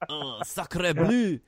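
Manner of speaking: high, hammy French exclamation; mock Frenchman gasping sacre bleu; French accent.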